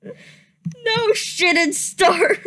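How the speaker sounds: loud no shit dissolving into giggles; can't believe it comes up again; laughing again. Real, not acted. Hits the line again, laughs.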